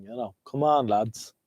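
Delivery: normal talking voice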